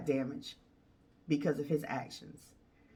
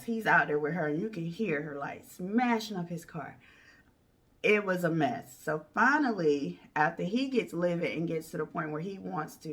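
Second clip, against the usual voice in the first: ordinary talk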